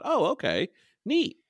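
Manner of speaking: high-pitched re-enacted thought; mildly impressed reaction. Host recalling first sight of a female leader, high impressed reaction. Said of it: "I thought".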